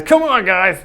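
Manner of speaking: loud, high call to the group; mock-exasperated shout; playful exhortation. Real, not acted. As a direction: prod the class, loud and jokey. Teasing prod to a silent audience.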